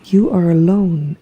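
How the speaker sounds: ordinary talk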